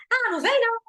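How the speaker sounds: mock furious snap; angry retort. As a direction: bite back hard. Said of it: "tô reagindo".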